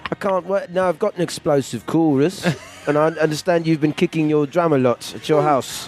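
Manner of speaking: mock working-class Scouse; Liverpool accent. English co-writer offers his chorus, in Liverpool accent. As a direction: talk like a working bloke from Liverpool. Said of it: "working class guy from Liverpool now".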